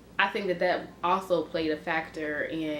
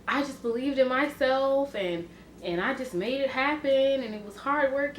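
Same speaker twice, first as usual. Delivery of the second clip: higher, hammed-up self-made-star voice; mock success story; 7 semitones higher than usual. Mocking other YouTubers' success stories in a higher parody voice. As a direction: parody a smug come-up story, pitched higher. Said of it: "you read the come up stories".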